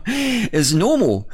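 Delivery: giggling through the words; can't hold back a laugh. Real, not acted. Laughing at his own crude joke, breaking into giggles.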